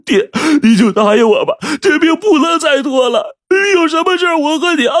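high, wailing plea; choked-up crying. A narrator's reading. Son kneeling before father, sobbing plea. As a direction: beg through sobs until words fail. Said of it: "他说不下去了".

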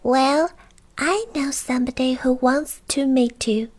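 high-pitched goblin with a secret; little goblin voice. Acted. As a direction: tell it in a high creature voice. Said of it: "a little voice".

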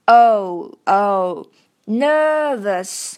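"o" and "nervous" said slowly; o and nervous drilled. Shown as a demonstration. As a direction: drill the vowel in "so", then "nervous". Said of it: "so要注意啊".

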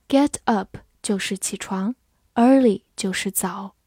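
normal talking voice

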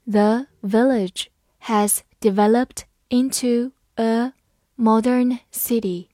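slow, deliberate read. Shown as a demonstration. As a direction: read it slowly word by word. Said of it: "好，慢慢来读。".

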